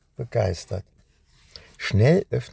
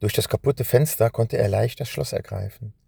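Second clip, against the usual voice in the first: plain reading voice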